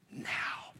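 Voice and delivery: whispered, barely voiced "Now"; hushed answer. Student answering whether he believes, in a hushed whisper. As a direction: breathe the word out very softly.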